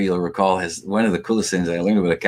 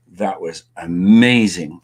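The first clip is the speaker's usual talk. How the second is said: awed praise; 2 semitones lower, 4 dB louder, much slower than usual. Retold or imitated. Retold praise after the drumming.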